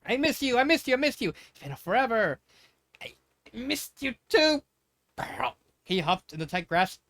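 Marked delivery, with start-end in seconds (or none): 0.1-5.6 excited reunion. Acted. Pearl hugging her cousin tightly.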